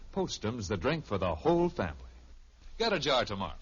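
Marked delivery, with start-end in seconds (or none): none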